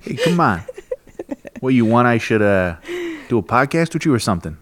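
put-on tough-talking New York accent. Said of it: "if I could do New York".

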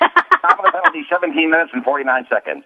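arena announcer reading out the penalty time; hockey rink PA sign-off; sports announcing voice. Shown as a demonstration. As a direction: start high, then settle into the official time call. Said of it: "as it would sound at the Boston Garden".

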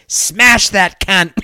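put-on drunk Aussie fan yelling, drawn out; hammed-up Aussie heckler; loose Aussie accent. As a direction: bellow it like a drunk Australian at the footy. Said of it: "That was my loose Aussie accent there".

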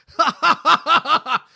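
big lean-back cackle building loud; high loud cackling laugh; full-blown cackle. Shown as a demonstration. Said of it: "starts out little and ends up in a full-blown cackle".

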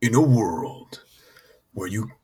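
deep, slow trailer announcer; slow epic movie-trailer narration. Pretend. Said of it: "Can I do, can I do the trailer".

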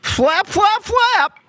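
high cartoon wing flaps; squeaky flap sounds. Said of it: "That's them flapping their wings".

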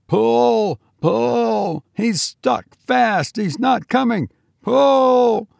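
high, straining repeated shouts; desperate shouting. A narrator's reading. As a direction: keep yelling as you haul the rope. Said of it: "shouted George".

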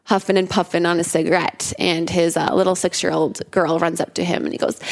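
ordinary delivery

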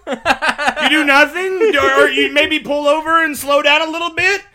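loud, high, questioning answers; hammed-up bewilderment at an obvious question; baffled guessing. Comedian replaying his guesses at a test question, baffled.

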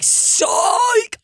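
spring the joke reveal in a squeaky shout; teacher unmasking herself, hammed-up high cry; mock gotcha reveal. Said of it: "She'll be like".

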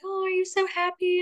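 high-pitched imitation of gushing well-wishers; high, gushy question in mock excitement; gushing onlookers. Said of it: "everyone was like".

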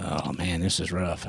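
low, soft, downhearted mutter; downhearted aside, dropped in pitch and volume; discouraged. Retold or imitated. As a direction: say it quietly to yourself, disheartened. His discouraged words while searching.

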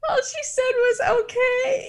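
very high, cracking with laughter; laugh-crying. Real, not acted. Co-host retelling Sarah's exit, high laugh-crying. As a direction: squeak it out through laughing tears.

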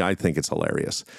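usual speaking voice

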